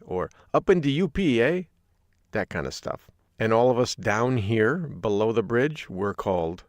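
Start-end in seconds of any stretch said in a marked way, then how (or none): 0.5-1.6 higher, louder mock Yooper accent. Host giving another sample of Yooper talk, playful Yooper accent. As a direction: do an Upper Peninsula local with a tagged-on "eh". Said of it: "They always end every sentence with".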